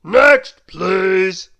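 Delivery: very loud roared call; milkman's huge shout; roaring. A narrator's reading. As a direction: roar for the next customer. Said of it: "roared the milkman".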